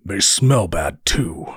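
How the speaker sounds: mild, acted distaste; a touch of disgust; understated revulsion in a character voice. A narrator's reading. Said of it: "disgust evident in his voice".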